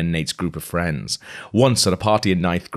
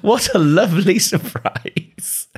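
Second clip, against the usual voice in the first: much higher, a put-on gushing hostess voice, laughing through it; gushing mother; 13 semitones higher, 4 dB louder, slower than usual. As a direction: gush like a society mother. Voicing Blair's mother, laughing.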